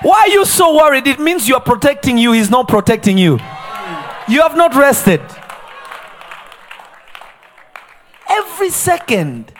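loud, high shouting sermon; fiery preaching. Acted. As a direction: preach it hard to the congregation. Preaching at full force.

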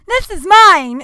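dumb mock outburst. Retelling a mask chat with a girl, loud and dopey. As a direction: play it dopey and insistent. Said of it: "I looked very dumb".

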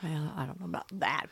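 hushed, coy aside; whispering. Real, not acted. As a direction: drop to a breathy hush. Coy denial of a compliment.

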